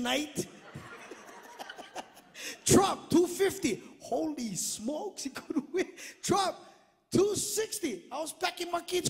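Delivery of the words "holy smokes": ordinary talk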